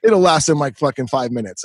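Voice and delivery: ordinary talk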